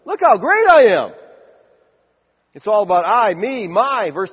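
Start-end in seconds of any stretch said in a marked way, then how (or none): 0.0-1.1 much higher, loud mock boast; hammed-up proud Nebuchadnezzar; boastful king. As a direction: crow like a vain king. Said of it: "he's enamored with himself".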